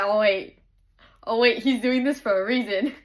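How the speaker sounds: loud dawning "oh wait"; sudden realization. Retold or imitated. Reader realizing why the hero is doing this, excited. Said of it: "I'm like".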